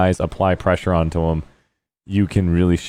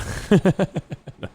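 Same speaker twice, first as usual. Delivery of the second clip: high laugh; 13 semitones higher than usual. Real, not acted. Laughs after the sound.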